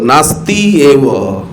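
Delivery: ordinary talk, nothing marked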